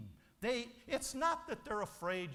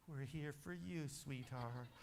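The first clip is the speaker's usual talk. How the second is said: much softer and lower, tender; soft reassurance; 6 semitones lower, 10 dB quieter than usual. Acted. Loving parent reassuring their child at an intervention, hushed. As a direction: murmur it gently to your child.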